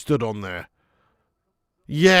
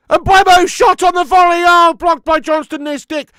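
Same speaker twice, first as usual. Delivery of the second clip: loud, high rising commentary call; excited shout; 12 semitones higher, 12 dB louder than usual. Real, not acted. Fan calling a chance live, loud and excited.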